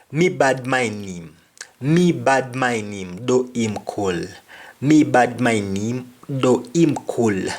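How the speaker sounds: patois Me bad mind him, though him cool; Jamaican patois. Shown as a demonstration. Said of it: "I hate him, though he's cool".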